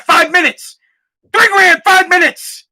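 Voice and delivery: rising to a high, loud shout; exasperated yelling. Real, not acted. Fed-up dad with no time for his Peloton, exasperated yell. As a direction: build into an outraged yell. Outburst over unused pricey Peloton.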